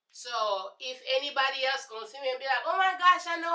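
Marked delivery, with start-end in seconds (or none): 2.7-3.5 mock fake friend.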